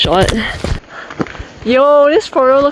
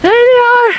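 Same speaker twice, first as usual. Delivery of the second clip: high excited call; excited shout; 13 semitones higher, 4 dB louder than usual. Real, not acted. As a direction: call out to friends, thrilled. Spots friends, calls out.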